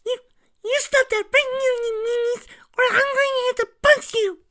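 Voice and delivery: put-on very high, loud child's voice threatening; tiny bunny trying to sound tough; cute little scold. Cream scolding the changelings in a high loud child voice. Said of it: "Cream looking up at some of the changelings and going".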